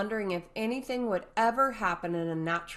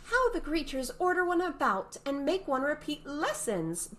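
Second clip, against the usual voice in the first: indignant Alice; 9 semitones higher than usual. A narrator's reading. Alice's thought.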